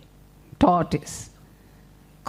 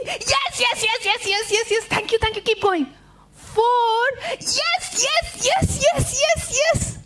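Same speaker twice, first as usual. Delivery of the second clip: eager cheering; 10 semitones higher than usual. Acted. Spider cheers the count.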